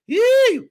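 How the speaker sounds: very high, loud praise yelp; ecstatic yelp. Real, not acted. Preacher breaking into praise before the congregation, a high yelp. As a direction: let out a piercing joyful Yee.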